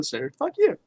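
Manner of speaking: ordinary talk, nothing marked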